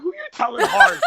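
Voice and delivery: high, loud incredulous comeback, half laughing; mock indignant. Veteran fighter retelling comeback to young partner, mock indignant. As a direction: pitch way up in disbelief.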